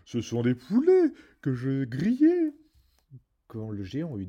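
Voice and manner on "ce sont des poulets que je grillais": high, loud and slow giantess voice with a fake laugh; laughing ogress. A narrator's reading. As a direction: cover for the boy with a booming laugh. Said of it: "l'ogresse se mit à rire".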